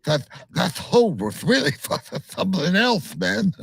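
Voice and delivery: high, spluttering cartoon lisp; Daffy Duck. Co-host riffing on toad venom, as Daffy Duck. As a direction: put on a lisping cartoon duck. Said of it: "did you just sound like Daffy Duck?".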